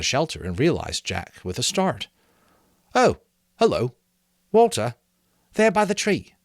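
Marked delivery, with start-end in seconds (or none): 2.9-6.3 high, loud, absent-minded reply; distracted boy's voice. A narrator's reading. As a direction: answer without looking up from the work. Ralph voiced in story.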